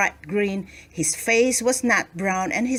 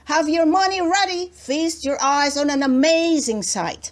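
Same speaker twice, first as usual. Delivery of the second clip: fast, louder barker's cry; a loud market crier; bawling; 7 semitones higher, 3 dB louder than usual. A narrator's reading. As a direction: hawk it to the crowd. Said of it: "bawled so loudly".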